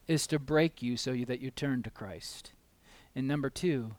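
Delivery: normal talking voice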